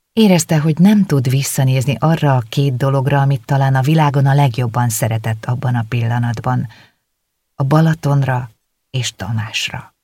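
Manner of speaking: plain reading voice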